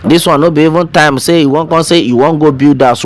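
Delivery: usual speaking voice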